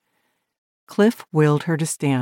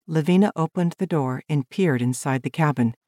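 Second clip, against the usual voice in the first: usual speaking voice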